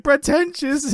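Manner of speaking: high-pitched incredulous echo; imitating a freak-out. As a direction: repeat it back in mock outrage, voice shooting up. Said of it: "Jerry's freaking out".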